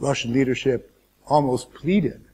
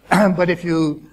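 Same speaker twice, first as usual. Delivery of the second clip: a real laugh breaking into the words, louder; laughing through words; 4 semitones higher, 6 dB louder, slower than usual. Real, not acted. Lecturer amused at his Irish comparison, laughing. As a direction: chuckle as you start the point. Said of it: "although I laugh when I say it".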